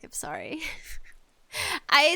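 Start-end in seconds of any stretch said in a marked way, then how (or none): 0.0-0.6 lower, soft mock apology; sheepish apology. To someone who hated the game, a soft sheepish apology. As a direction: mumble a small apology. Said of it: "I'm like".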